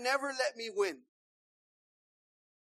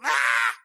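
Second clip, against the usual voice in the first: mock child cry; 9 dB louder than usual. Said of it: "like that".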